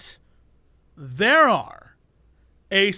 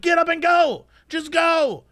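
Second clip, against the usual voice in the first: raised, higher mock yell at lingering diners; mock angry shout; 8 semitones higher, slower than usual. Fed-up restaurant customer yelling at lingering diners, mock angry shout. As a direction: bark them out of their seats, half in jest. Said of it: "I was like".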